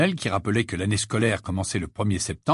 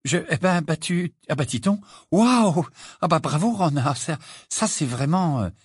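higher, halting flustered congratulations; stammering, stunned; 5 semitones higher than usual. A narrator's reading. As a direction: fumble for words, stopping and restarting. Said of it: "balbutia Hermione abasourdie".

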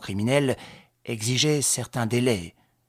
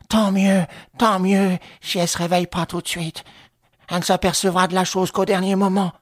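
put-on high, loud old crook's voice; insistent, raised-voice relief; relieved accomplice; 8 semitones higher, 6 dB louder than usual.

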